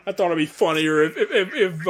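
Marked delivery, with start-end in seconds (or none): none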